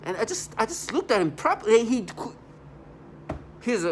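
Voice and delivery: worked-up emphasis; agitated. Real, not acted. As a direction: stress it with heat. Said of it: "agitated retelling".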